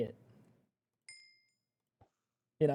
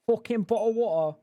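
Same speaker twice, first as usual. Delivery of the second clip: Cockney "bottle water" with dropped t's and louder; mock British accent; 5 semitones higher, 4 dB louder than usual. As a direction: sneer it in a thick Cockney accent. Mocking British pronunciation.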